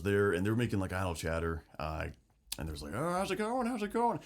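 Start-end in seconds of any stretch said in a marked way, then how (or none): 2.9-4.2 much higher, sing-song polite chitchat; mock small talk. Host mimicking a commissar making idle chatter, high and chirpy.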